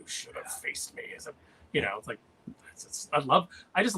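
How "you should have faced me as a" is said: whispered growl, rough and breathless; growly Megatron voice. Retold or imitated. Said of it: "growly Megatron that he does".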